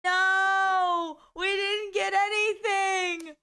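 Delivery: high, loud, drawn-out protest; dismayed wail. Real, not acted. Streamer crew got nothing from the heist, dismayed wail.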